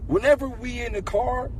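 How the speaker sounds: normal talking voice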